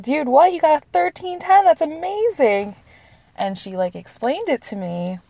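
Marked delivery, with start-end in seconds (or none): 0.0-2.8 high, loud, incredulous excitement; amazed friend. Retold or imitated. Friend reacting to her test score, amazed. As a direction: gush in astonishment, pitched way up.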